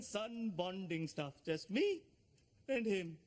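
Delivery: put-on excitable Pakistani accent; father insisting in a Pakistani accent. Mr Khan's held accent.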